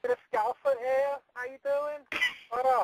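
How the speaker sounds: high mock Scouse accent; hammed-up Liverpool greeting; teasing Scouse impression. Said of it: "thick Scouse accent".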